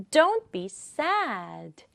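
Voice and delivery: "Don't" and "sad" punched, "be" light; stress on "Don't", "sad". Shown as a demonstration. Teacher demonstrating rhythm, stress on "Don't" and "sad". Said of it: "어디 강조할까요? 두 개".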